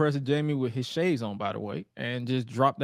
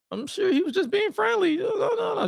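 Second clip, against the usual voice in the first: put-on much higher girly voice; high woman voice. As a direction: voice her up high.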